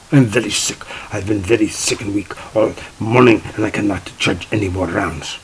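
mock German accent, weak and sickly. Judge excuses herself from judging, mock German accent. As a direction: imitate a German judge pleading sickness. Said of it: "in a very strong German accent".

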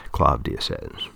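ordinary delivery

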